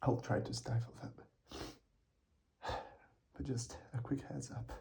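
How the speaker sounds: breathless whispered apology; whispering. Real, not acted. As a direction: whisper it, embarrassed.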